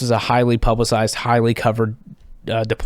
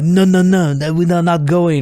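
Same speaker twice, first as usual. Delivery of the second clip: put-on high, protesting French accent; French reporters; 6 semitones higher, 3 dB louder than usual. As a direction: imitate French reporters refusing to budge. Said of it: "these two French reporters".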